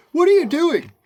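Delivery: a baffled passerby calling out; high, loud mock bystander; squeaky onlooker. Imagined onlooker asking.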